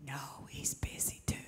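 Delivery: put-on hushed little boy whisper. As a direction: keep it unvoiced and secretive, like hiding. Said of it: "He whispered".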